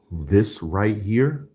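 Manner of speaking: very slow, rising at the end; drawn-out savouring. Real, not acted. Reviewer presents the food he loves, savouring slowly. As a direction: stretch each word and lift on here.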